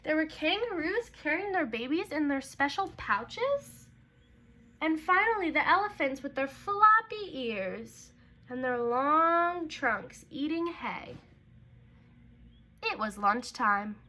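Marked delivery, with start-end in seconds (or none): none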